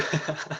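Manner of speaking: very soft, short laugh; quiet chuckle. Real, not acted. Teacher amused at saying a slangy word, quiet chuckle.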